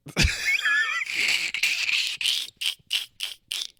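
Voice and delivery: high unvoiced shriek of laughter. Real, not acted. Streamer cracking up at a tweet he found, shrieking laugh. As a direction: lose it in a wheezy high shriek.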